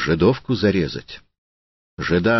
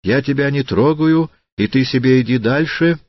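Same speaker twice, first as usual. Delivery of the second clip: high, louder voice of protest; crying out; 8 semitones higher, 5 dB louder than usual. A narrator's reading. Widow tells the hooligan to leave her be, crying out. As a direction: call out in high-pitched protest. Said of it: "закричала еврейка".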